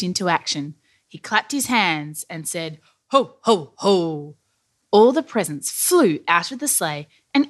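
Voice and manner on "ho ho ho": spoken jolly laugh, evenly spaced; Santa's ho-ho-ho. A narrator's reading. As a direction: boom out a Father Christmas chortle.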